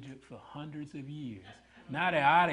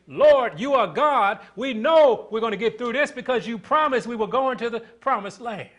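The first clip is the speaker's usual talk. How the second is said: loud, lifted-up prayer of trust; faithful cry to God; 8 semitones higher, 10 dB louder than usual. Acted. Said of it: "They didn't cry out saying".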